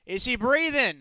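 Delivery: loud, high shouted question; urgent shout. Acted. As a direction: shout it across to the wounded men. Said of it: "I shout while getting my violin ready".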